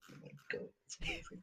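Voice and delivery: breathless delighted whisper. Real, not acted. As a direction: whisper it in awe. Raving about Chinese eggplant.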